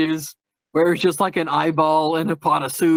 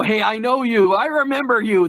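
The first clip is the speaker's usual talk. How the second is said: verbal tic; 5 semitones higher than usual. Retold or imitated. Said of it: "Those are all verbal ticks".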